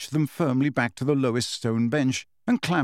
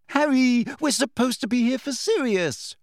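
much higher, tight, straining; high-pitched and strained; 11 semitones higher than usual. A narrator's reading. Hermione pleading with Harry, high-pitched and strained. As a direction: voice pulled thin with worry. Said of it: "high-pitched, strained voice".

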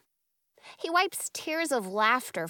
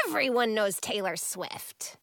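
ordinary delivery

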